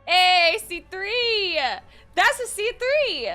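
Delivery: loud, high celebratory call; excited cheering. Real, not acted. Streamer celebrating a C three, excited shout. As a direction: cheer it out, stretching the last word.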